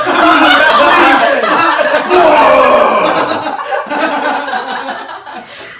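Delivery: long, loud, high-pitched laughing fit; real helpless burst of laughter; uproarious laughter. Real, not acted. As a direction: crack up completely and keep going. Group cracks up at joke.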